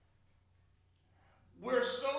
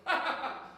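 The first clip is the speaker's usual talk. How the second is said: loud, high ha-ha-ha; imitated laugh; 7 semitones higher, 6 dB louder than usual. Preacher shows how people laugh at something funny, high mock laughter. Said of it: "you go".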